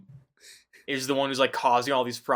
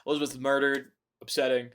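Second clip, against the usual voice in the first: normal talking voice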